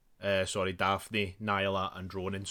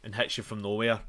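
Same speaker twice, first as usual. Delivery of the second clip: ordinary delivery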